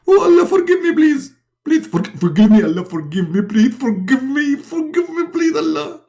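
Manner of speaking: very high, panicked mock pleading; frantic hammed-up begging; Muhammad. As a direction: beg for mercy in a squealing comic voice. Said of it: "and Muhammad, he answer".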